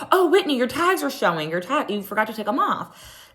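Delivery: high put-on helpful voice; mimicking Lisa. Imitating Lisa telling Whitney about her tags, high mock voice. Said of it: "and she was like".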